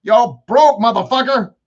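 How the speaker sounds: high, loud mocking verdict; punchy voiced-up bank account; scolding bank account. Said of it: "my bank account says".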